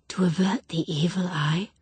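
hushed eerie whisper. A narrator's reading. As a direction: breathe it like a charm. Drowsy thought.

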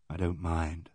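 put-on he gently reassures the shaken girl, low and soft; gentle reassurance. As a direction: soothe her quietly.

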